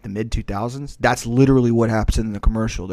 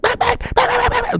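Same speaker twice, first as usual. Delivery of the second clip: very high-pitched rapid dog yaps; yapping Chihuahua; 3 dB louder than usual. Retold or imitated. Man mimicking his friend's noisy Chihuahua, high-pitched yapping. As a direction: imitate a tiny dog barking its head off. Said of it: "his little Chihuahua's like".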